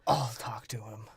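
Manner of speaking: hushed, breathy whisper of a grudging aside; muttered under the breath. Retold or imitated. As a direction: drop to a whisper as if resigned. Acted quote, whispered.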